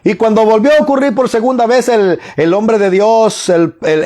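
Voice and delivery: ordinary talk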